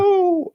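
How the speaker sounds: very high "oh" howl; imitated fox howl. Retelling the fox stepping in, imitated high howl. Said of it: "starts this whole howling frenzy".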